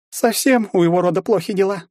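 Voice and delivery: high woman's voice, meant to be overheard; disdainful mother. A narrator's reading. Mother speaking after the hero so he hears, disdainful. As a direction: remark it pointedly in a high voice.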